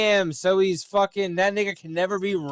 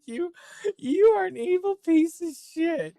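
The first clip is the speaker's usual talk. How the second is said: much higher put-on voice scolding; mock angry woman; 13 semitones higher than usual. Imagining her reaction, mock high scolding woman. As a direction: play her telling him off in a shrill voice. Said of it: "she would be like".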